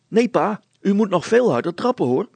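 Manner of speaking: put-on high, loud, quick boy's voice; eager boy. Hannes urges his pedalling father on, high and eager.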